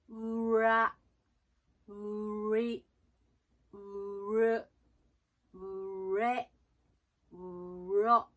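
うら, うり, うる, うれ, うろ as long "u" gliding into English R; R started from う. Shown as a demonstration. Coach showing that う and R are a set, u-led English R in うら to うろ. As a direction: hold each う, then release into rounded R. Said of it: "Rの最初は、うで始めてください".

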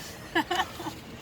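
short high, breath-only laugh; laughter. Real, not acted. After joking about sharing a bed, laughing. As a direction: giggle without voice.

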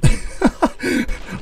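airy high laugh of relief; real relieved wheeze; breathless disbelieving laugh. Real, not acted. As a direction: laugh it out, can't believe it. Laughs after surviving.

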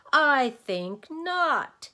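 very high, loud, slow creature voice; din's refusal. A narrator's reading. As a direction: boom the refusal, dragging it out. Din refuses Milo's suggestion.

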